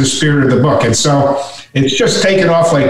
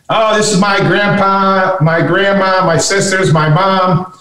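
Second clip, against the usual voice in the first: higher, sing-through list of introductions; lightly voiced son; 5 semitones higher than usual. Son introduces his family to the senator, lightly imitated.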